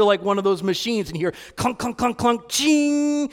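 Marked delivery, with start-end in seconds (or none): 1.6-3.3 clunking coin, then a rising ching; imitated coin drop. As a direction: imitate a coin rattling down a machine into a ringing ching. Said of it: "like a coin being dropped".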